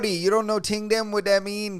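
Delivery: high, fast put-on Toronto slang; mock Toronto accent. Mocking Toronto slang to co-host, exaggerated Toronto accent. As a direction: rattle it off in a clowned Toronto accent. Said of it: "people are clowning that accent".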